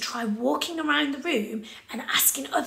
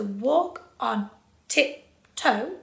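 slow, separated "walk on tiptoe"; "walk on tiptoe" as four slow crotchets; four even beats; much slower than usual. Shown as a demonstration. Teacher shows where the beat falls, slow even beats. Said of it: "they are our four crotchets".

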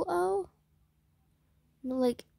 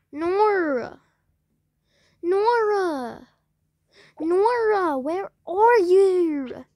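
calling Nora in a high, loud put-on accent; 5 semitones higher, 7 dB louder, slower than usual. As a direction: shout for Nora in the made-up accent, drawn out. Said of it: "I love doing that accent".

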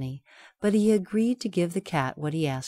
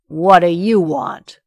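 slow, loud ogre growl; 12 dB louder than usual. Acted. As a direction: growl it menacingly. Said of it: "growled the ogre".

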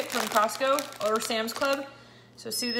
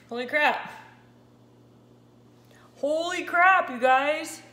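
higher and louder, real disbelief; shocked exclaim; 5 semitones higher, 7 dB louder than usual. Real, not acted. Stunned by her ketone reading, a loud real holy crap. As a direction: blurt it out, stunned by the number.

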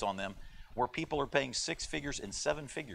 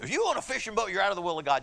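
mock preaching; 5 semitones higher, 4 dB louder than usual. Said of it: "I could preach against fishing".

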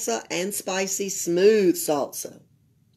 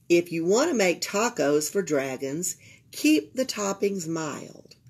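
plain reading voice

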